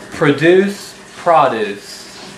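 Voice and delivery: "produce produce": first pruh-DOOCE, then PRAH-dooce, slowly; two pronunciations. Shown as a demonstration. Teacher slowly contrasting the two readings of "produce produce". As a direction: say the verb "produce" stressed at the end, then the noun "produce" stressed at the front. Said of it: "It's the word produce and produce".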